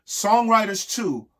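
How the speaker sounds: usual speaking voice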